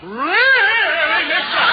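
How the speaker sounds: laughing, drawn-out and loud; laughing loudly. Acted. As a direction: burst out laughing through it.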